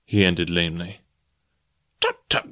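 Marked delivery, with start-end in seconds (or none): 2.0-2.5 squeaky high-pitched tutting; high tut-tut. Acted.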